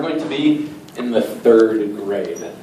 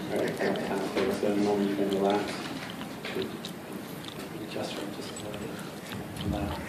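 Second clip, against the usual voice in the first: hypnotic induction; 5 semitones lower, 17 dB quieter than usual. Acted. Stage hypnotist softly putting volunteers under, soothing murmur. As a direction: lull them down into trance.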